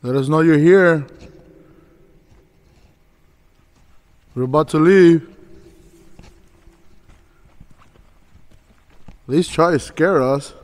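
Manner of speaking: loud, high challenge to the empty rooms; calling into the dark. Real, not acted. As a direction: taunt the ghosts loudly. Taunting spirits in the dark.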